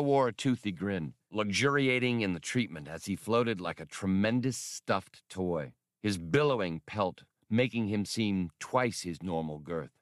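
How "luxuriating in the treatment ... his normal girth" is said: usual speaking voice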